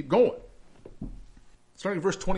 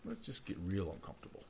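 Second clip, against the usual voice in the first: much softer, lower and intimate; hushed invitation; 8 semitones lower, 16 dB quieter than usual. Real, not acted. Preacher to congregation before a hard passage, hushed. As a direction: draw them close, almost under the breath.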